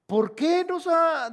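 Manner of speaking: high, loud, slow protest; Gideon's questioning. Retold or imitated. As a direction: stretch out an aggrieved question as Gideon. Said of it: "Y tanto que dice allí Gedeón".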